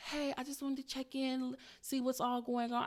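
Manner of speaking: lightly voiced checking-in caller; breezy retold phone hello; client's mother.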